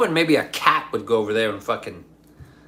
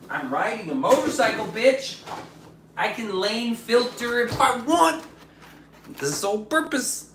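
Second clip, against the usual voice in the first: higher put-on taunting voice; lightly voiced cocky biker, higher; mock defiant rider; 10 semitones higher than usual. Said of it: "I was like".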